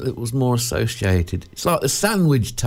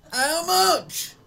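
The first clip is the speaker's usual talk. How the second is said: high, outraged disbelief at the price; a wailing cry of price shock. Real, not acted. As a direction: squeal it out in horror at the cost.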